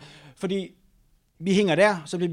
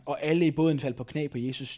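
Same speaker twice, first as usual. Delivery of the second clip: usual speaking voice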